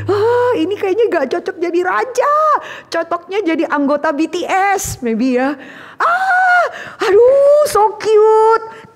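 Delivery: squealing high, giddy fan voice; gushing fangirl. Acted. Samuel swooning over the boy David like a fan, high squealing. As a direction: swoon like a teen over a pop idol. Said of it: "Kayak anak-anak sekarang itu".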